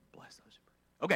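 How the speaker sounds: a grudging whispered prayer. Pretend. Preacher grudgingly praying for his persecutors, whispered. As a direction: whisper "Bless those who persecute me" with no voice. Reluctant prayer repeated as aside.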